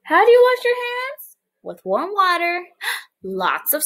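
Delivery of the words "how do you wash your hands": excited question. Acted. Teacher quiz.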